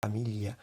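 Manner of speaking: familia with the Italian gl, quiet. Shown as a demonstration. Teacher softly trying the Italian gl. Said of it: "palabra con G L en italiano".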